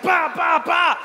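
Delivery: high, punched-out bursts; imitated punch noises. Comedian acting out his own wild punches, high mock battle bursts. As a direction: sound out wild swings like axe blows.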